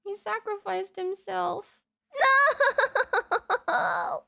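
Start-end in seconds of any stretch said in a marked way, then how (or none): 2.1-3.6 high, laughing sob of dismay; half laugh, half cry at the loss; laugh-cry wail. Real, not acted. Character sacrifice scene.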